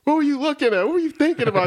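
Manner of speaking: high-pitched, mock-outraged coach scolding; angry skipper. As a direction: chew out a player, voice climbing. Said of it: "My skip would be in my face".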